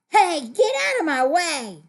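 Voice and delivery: loud, high mock-rude order; rude demand. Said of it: "Should you say ... No".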